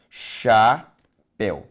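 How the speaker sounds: chapéu split into slow syllables, cha-péu; syllabified slowly. Shown as a demonstration. Teacher to class, spelling out syllables slowly. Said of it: "faço a separação silábica de chapéu".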